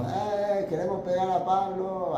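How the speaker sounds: high, mocking crowd voice, acted out; preacher voicing the mob that wants to hit Pablo; angry mob voice. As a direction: play the rioters wanting to beat Pablo.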